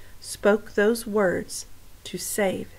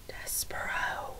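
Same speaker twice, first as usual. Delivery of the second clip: slow breathless whisper of the name; 14 dB quieter, slower than usual. Acted. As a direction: whisper it in disbelief. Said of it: "he whispered".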